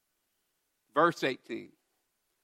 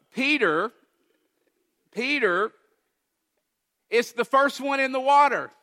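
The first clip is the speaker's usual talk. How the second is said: higher and louder with delight; excited; 7 semitones higher, 4 dB louder than usual. Real, not acted. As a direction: build it up, thrilled about Peter. Preacher's excitement about Peter.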